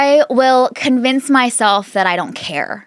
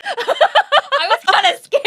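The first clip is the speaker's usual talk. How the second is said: high, slow, through laughter; laughing so hard the words stretch. Real, not acted. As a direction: squeal it out while cracking up. Joking admission amid laughter.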